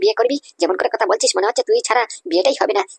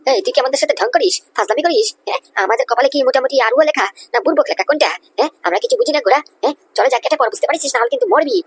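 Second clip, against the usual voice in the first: angry threats; 6 semitones higher than usual. Acted. Guard threatens the intruder.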